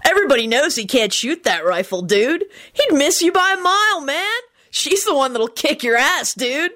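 high-pitched slacker-dude voice; Kenny. A narrator's reading. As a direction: talk like an unbothered, knowing dude. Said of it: "Kenny said knowingly".

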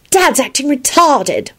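high, slow stubborn complaint. A narrator's reading. Ginny complains about her dad, stubborn. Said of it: "she replied stubbornly".

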